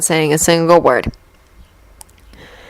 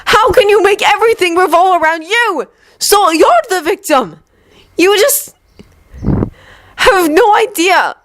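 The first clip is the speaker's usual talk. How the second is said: high, slowing bitter cry; fighting back tears; tearful anger; 12 semitones higher than usual. Acted. Yuri berates Victor, angry and near tears.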